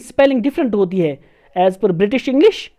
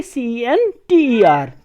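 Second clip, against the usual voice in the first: slow letter-by-letter dictation; slow teacher spelling; much slower than usual. Shown as a demonstration. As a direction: spell each letter slowly. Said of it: "American में आप कैसे लिखोगे".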